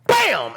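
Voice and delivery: loud, high, sudden bang; explosive sound effect; imitated door slam. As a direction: hit it like a slamming door.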